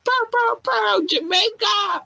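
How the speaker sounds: high mock air horn blasts. Host imitating an air horn for Jamaican fans. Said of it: "I need a horn like".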